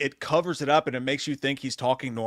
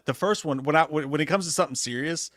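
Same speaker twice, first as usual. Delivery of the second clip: ordinary delivery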